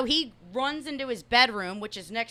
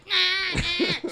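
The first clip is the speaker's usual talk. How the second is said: imitating Missus Matt; 7 semitones higher than usual. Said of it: "That sounds just like Missus Matt".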